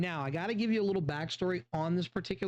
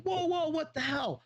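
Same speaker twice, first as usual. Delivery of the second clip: much higher, startled whoa; high panicked cry at a charging dog; alarmed cry; 9 semitones higher than usual. Retold or imitated.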